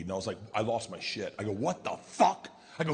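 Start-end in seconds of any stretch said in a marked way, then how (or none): 1.5-2.5 sharp outraged yell, a bit higher; shocked dad losing it; angry outburst. Retold or imitated. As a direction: blurt it out in disbelief. Lost my shit at news.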